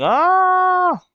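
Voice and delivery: long, high, loud yell; sustained high scream of "ah". Acted. Reader acting out Rox as he keeps yelling, high loud yell. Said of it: "So he keeps yelling".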